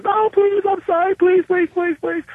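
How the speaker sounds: hammed-up weepy wife, squeaky and pleading; mock sobbing wife. Caught wife begging forgiveness from husband, mock sobbing falsetto.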